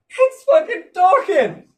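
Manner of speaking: slow, high, loud shout of alarm; panicked yell. Acted. Lab scientist yelling that the thing is talking. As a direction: yell it in disbelief.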